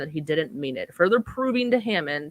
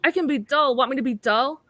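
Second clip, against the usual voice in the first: pleading voice; 6 semitones higher than usual. Retold or imitated.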